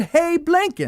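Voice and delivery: put-on very high English-accented call; English film-character accent. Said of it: "Expert on English accents".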